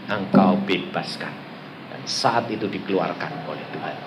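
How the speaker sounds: low, soft surrender to God; hushed prayer. Retold or imitated. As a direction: pray quietly and humbly. Retells a private prayer.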